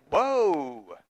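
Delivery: long, drawn-out "whoa", swelling up then falling; awed amazement. Acted. Preacher reacting to the meeting of Mary and Elizabeth, awed. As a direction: stretch it out in amazement. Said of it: "I think about that meeting, it's like".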